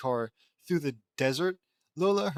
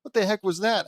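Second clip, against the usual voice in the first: higher, louder startled question; 7 semitones higher, 7 dB louder than usual. Acted. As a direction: jolt at the stampede. Lincoln startled by stampede.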